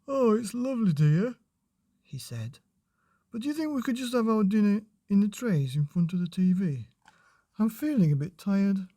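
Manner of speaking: a hint of a weary father; lightly voiced tired husband; dad sinking onto sofa, lightly tired.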